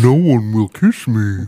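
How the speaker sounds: slow, put-on horse voice. Comic riff on a horse-headed man, horse voice. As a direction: moan it slowly as a talking horse. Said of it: "That's the ultimate horse voice".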